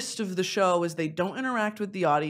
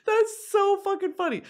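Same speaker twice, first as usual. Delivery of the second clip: ordinary talk, nothing marked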